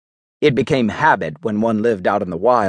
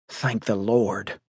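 relieved; 8 dB quieter, slower than usual. Acted. Relieved brother slumps against rail.

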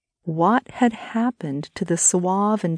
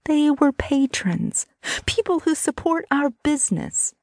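high-pitched, upset defense; indignant, hurt protest; 10 semitones higher than usual. Acted. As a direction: defend yourself in a high, wounded voice. Brittany protests his accusation.

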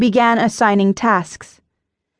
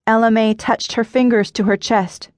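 ordinary delivery, nothing marked

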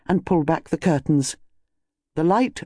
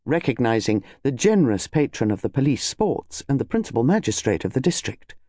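plain reading voice

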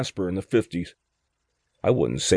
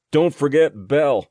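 raised, higher voice calling out; retold yell; 6 semitones higher, 4 dB louder than usual. Injured man calls out for his dog, voice raised. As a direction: shout after the men not to forget the dog. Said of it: "me yelling".

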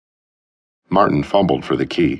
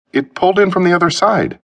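nervous lie; 4 dB louder than usual. Acted. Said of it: "Martin lied".

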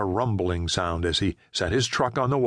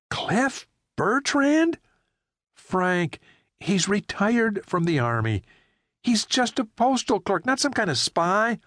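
higher frightened driver demanding answers; alarmed Jerry; 12 semitones higher than usual. Acted. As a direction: press him, rising in alarm. Jerry disbelieving.

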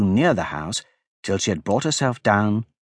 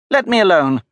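indignant; 8 semitones higher, 4 dB louder than usual. A narrator's reading. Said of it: "said Alice indignantly".

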